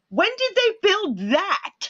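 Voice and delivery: high, slow, stunned question; astonished. Retold or imitated. Mother spotting the new store, high and slow astonishment. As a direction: gasp it out in disbelief. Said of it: "I was like".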